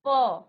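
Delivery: प burst out hard through closed lips, loud; loud demo pa. Shown as a demonstration. Teacher getting the class to feel the breath stop on प, loud. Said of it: "आप उच्चारण कीजिए और फिर महसूस कीजिए".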